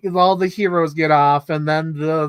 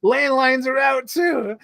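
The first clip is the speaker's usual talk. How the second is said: mock exasperated; 7 semitones higher, much slower than usual. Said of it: "and he's like".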